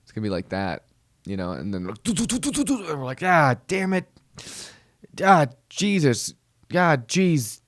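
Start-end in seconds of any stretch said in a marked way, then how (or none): 2.0-2.9 high, rapid rat-a-tat mouth noises; mock gunfire. Imitating enemy fire. 3.2-7.6 mock panic. Soldier cursing under attack.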